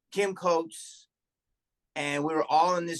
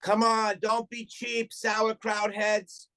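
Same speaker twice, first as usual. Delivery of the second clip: high, slow jeering taunt; mock taunt; 6 semitones higher, slower than usual. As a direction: jeer at them up high, drawing out sauerkraut. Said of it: "I stood up and I was like".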